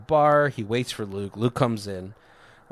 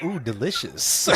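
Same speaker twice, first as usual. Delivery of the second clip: giggly campy ooh with a laugh; mock delight; 2 semitones higher than usual. Teasing about his own taste for fruity drinks, campy delight. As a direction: coo over the drink, playful.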